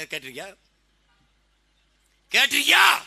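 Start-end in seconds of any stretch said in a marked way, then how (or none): 2.3-3.0 loud, high, rising shout; shouted challenge. Real, not acted. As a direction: fire the question at the whole crowd. Shouted repeat of his question. (உரத்த அதட்டல் கேள்வி)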